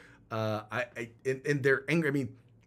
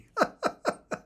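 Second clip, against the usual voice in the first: high squealing laugh; burst of laughter. Real, not acted. Host cracking up at the comedy clip, squealing laugh.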